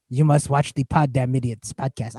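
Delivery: lightly put-on Spanish accent, low and soft; a hint of a Spanish accent, grave verdict. Host sentencing Swedes to his podcast, mock Spanish accent. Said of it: "they have a Spanish accent".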